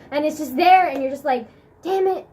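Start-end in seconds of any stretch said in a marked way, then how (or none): 1.8-2.2 high, loud mock exasperation; acted frustration. Stuck needing the bathroom at school, mock frustration. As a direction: curse your awful timing, hammed up. Acts out frustration of bad timing.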